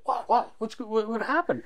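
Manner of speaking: high, stammering mock alarm; hyper guy. Hyper attendant reacts to a rumor, stammering excitedly. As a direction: imitate a jumpy guy firing questions. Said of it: "he's a real hyper guy".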